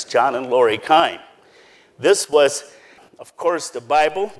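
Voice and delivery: ordinary talk, nothing marked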